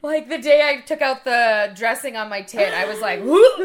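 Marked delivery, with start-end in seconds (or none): 3.2-3.6 loud, high single whoop; imitated queasy gagging. Recalling removing the dressing, imitated gagging. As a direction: mimic a sudden heave. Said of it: "making me sick to my stomach".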